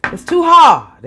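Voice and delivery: emotional outburst; emphatic. Real, not acted. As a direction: push out hard with feeling. Emphatic louder take.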